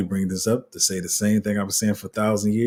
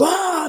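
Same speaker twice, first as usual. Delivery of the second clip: very high excited shriek; excited crowd yelp. Retold or imitated. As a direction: squeal with joy at the baby. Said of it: "everybody goes".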